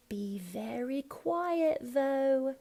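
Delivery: high, slow "quiet" with no t; Cockney T and TH. Shown as a demonstration. Coach voicing Horace's warning, Cockney T and TH. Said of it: "no final T on quiet".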